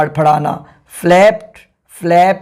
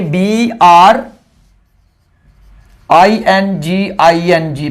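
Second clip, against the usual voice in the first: slow spelling out; much slower than usual. Shown as a demonstration. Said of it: "bringing हो जाएगा".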